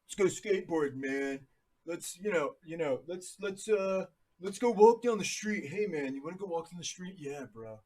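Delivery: laid-back surfer chatting with a buddy; California dude. Retold or imitated. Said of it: "talks like Michelangelo".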